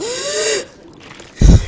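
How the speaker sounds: loud high gasp of fright; startled loud gasp. Real, not acted. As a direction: jolt back with a gasp. Sudden scare in the game.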